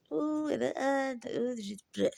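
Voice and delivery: high, syrupy fake pity; mock sympathy. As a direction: parody the fussing sympathizers. Mocking people's sympathy.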